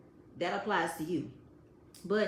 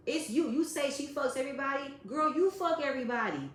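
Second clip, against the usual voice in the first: ordinary talk, nothing marked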